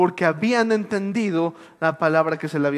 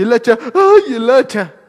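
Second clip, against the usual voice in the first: higher, louder startled yelp; startled cry; 5 semitones higher, 9 dB louder than usual. Acted. Preacher acts the crowd as the axe floats, startled cry. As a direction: gasp it out in shock.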